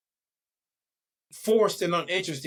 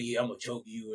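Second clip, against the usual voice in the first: low, hushed mock sultry line; mock sultry threat; 8 semitones lower, 9 dB quieter, faster than usual. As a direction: drop very low and quiet, playing a client. Mocking a client's line.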